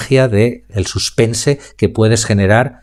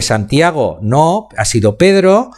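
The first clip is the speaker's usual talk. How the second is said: "no" drawn out slowly, with "Pedro" jumping much higher; stress on Pedro; 4 semitones higher, slower than usual. Shown as a demonstration. Speaking coach showing students how shifting the emphasis changes the meaning. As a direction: linger on "no", then fire "Pedro" up high. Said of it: "estoy resaltando partes distintas de mi frase".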